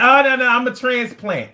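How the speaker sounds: high, quick-to-deny "oh no, no"; retold protest. Newcomer denying he's a local, mildly high-pitched protest.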